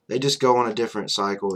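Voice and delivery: normal talking voice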